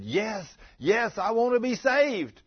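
high, loud voice of a woman pleading to be saved; eager woman. Retold or imitated. Freed woman accepts Jesus.

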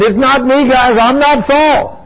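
high-pitched pleading denial, drawn out at the end; lightly acted whiny denial; mock denial. Saul retold denying who he is.

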